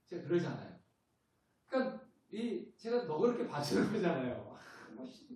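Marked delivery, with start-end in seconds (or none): 4.6-5.3 grumble. Retold or imitated. Muttering his grumbling resentment.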